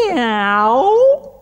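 noisy cat meow. Retold or imitated. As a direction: yowl like a cat. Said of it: "They went".

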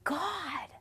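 high, soft plea; imitating a defeated cry to God; small, hurting voice; broken prayer. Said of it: "I felt like a failure".

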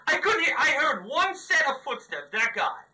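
loud, high-pitched exasperated rant with laughs. Real, not acted. Player ranting loudly to his team about dying. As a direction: vent loudly about the lucky headshot. Frustrated rant after death.

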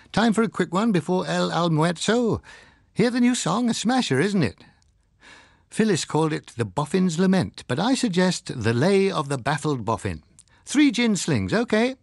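breezy, high-pitched cheerful chatter. A narrator's reading. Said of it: "he said cheerfully".

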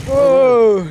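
startled whoa. Real, not acted. Near crash on bike.

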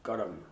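gorum said as two syllables, GOR-um, stress on the first; Gaelic pronunciation. Shown as a demonstration. Teaching the Gaelic word for blue, gorum stressed GOR-um. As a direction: sound the joining vowel, GOR-um. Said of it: "it's pronounced gorum".

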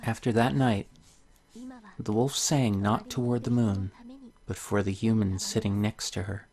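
soft, gentle storybook reading; story narration. Acted. Reading a tale to viewers, soft storybook voice. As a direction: read the fairy tale softly to the viewers. Reads the game's story aloud.

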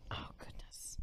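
whispered aside. Real, not acted. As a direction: breathe it under your breath. Mic trouble aside.

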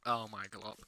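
ordinary talk

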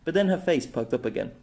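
usual speaking voice

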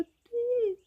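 very high little peep; tiny high mouse voice. A narrator's reading. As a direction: squeak it small, like a mouse. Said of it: "уже тихіше пропищали мишенята".

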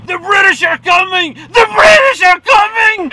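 very high, very loud town-crier alarm; mock warning shout. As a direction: holler a hammed-up warning, drawing out the repeat. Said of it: "I have to warn people".

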